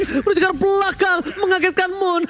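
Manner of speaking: high, excited play-by-play call; fighting game announcer. Real, not acted. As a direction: hype the play like a live caster. Hyped caster call of a play.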